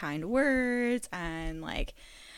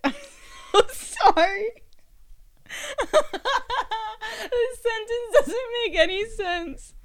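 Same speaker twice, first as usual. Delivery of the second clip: giggling through the words, pitch way up; losing it mid-sentence, laughing hard; laughing fit; 14 semitones higher, 7 dB louder than usual. Real, not acted. Host breaks up laughing over a botched sentence, can't stop. Cracking up while recording.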